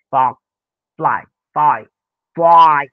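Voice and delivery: fie pushed out loud in disgust. Shown as a demonstration. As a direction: spit it out with aversion. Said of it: "अव्हर्शन, तिटकारा".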